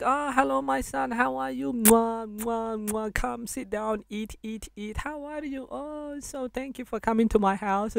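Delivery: high, soft, doting mother greeting a guest; shower the guest with love, voice lifted high; loving mum. Retold or imitated. Mum welcoming son's friend home, high doting motherly voice. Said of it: "She'll just be like".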